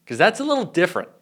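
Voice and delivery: ordinary talk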